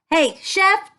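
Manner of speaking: ordinary talk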